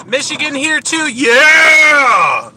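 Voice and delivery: loud, high whoop of home pride; excited cheer. Real, not acted. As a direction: shout it out proudly. Cheering for home state Michigan.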